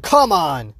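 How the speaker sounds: re-enacted loud exclamation; loud mock-exasperated cry; out-loud outburst. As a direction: blurt it out loud like a viewer reacting. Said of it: "out loud went".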